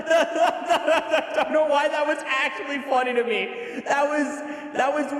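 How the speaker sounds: high, slow words through laughter; laughing while talking. Real, not acted. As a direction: talk while still giggling.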